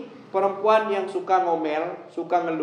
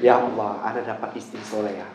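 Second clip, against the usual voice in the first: grateful husband; 7 semitones lower than usual. Retold or imitated. Suami terharu bersyukur. (suami terharu, suara pelan)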